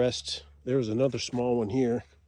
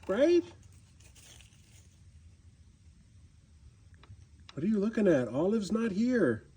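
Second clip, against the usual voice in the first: talking to a cat; 6 semitones higher than usual. Real, not acted. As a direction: coax the cat out gently. Calling the cats outside.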